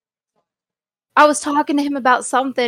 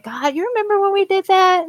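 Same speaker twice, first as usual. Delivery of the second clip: fond recollection; 9 semitones higher than usual. Retold or imitated. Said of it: "and I was like".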